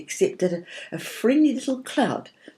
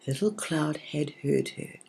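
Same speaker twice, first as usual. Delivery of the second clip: plain reading voice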